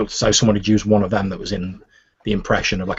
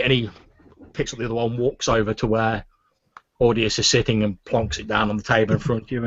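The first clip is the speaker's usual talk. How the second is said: ordinary delivery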